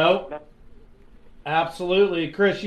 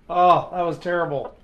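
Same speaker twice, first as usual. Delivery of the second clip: ordinary talk, nothing marked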